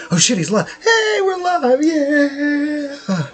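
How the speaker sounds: startled high yelp; surprised. Real, not acted. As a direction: caught off guard, voice jumps up. Realises the stream is live.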